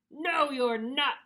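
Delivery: slow, loud troll refusal; storybook troll. A narrator's reading. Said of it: "said the troll".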